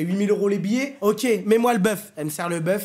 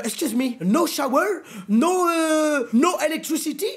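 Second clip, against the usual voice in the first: high, whining broken-English protest; outraged complaint; 8 semitones higher than usual. Retold or imitated. Passenger complaining about no shower or electricity, high-pitched outrage. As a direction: wail indignantly in heavily French English.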